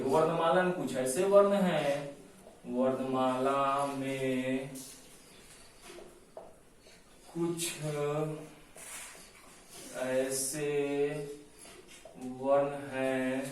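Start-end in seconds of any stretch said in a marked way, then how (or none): 2.7-13.4 very slow, stretched words with long pauses; slow dictation. Shown as a demonstration. Teacher dictating a definition for notes, slow dictation. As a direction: dictate phrase by phrase, drawing out each word. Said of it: "ऐसे लिखिए".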